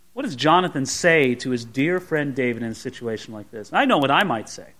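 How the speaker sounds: ordinary talk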